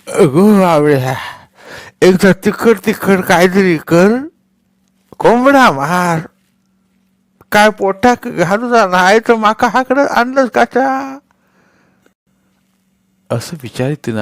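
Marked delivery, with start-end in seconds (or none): 0.1-11.2 put-on loud, high, slow cracked demand of an old hag; angry old woman. A narrator's reading. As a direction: shriek at her like a cranky old crone, drawn out. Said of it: "म्हातारी मध्येच एकदम रागानं म्हणाली".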